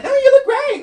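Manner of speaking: put-on chirpy insincere reassurance to a messy drunk star; fake sweet flattery. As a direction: sell the lie with a bright sugary voice. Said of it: "here's where I was evil".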